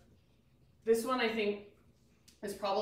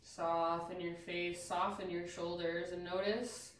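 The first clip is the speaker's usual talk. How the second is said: guided meditation; 4 semitones lower, 4 dB quieter than usual. Acted. Leads stillness after breathwork.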